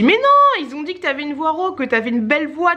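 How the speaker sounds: retold protest. Acting out her reply to him.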